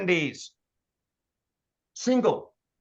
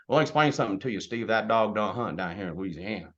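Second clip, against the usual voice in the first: put-on folksy Louisiana accent, lower at the end; Danny's Louisiana drawl; 7 semitones lower than usual. Trainer imitating an older colleague explaining things, Louisiana accent. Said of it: "And he talks like this, says things like".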